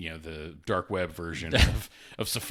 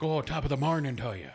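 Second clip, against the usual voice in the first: higher-pitched imitation Irish lilt; mock Irish accent; 6 semitones higher than usual. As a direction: put on a stock Irish greeting voice. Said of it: "my Siri on my phone is an Irish".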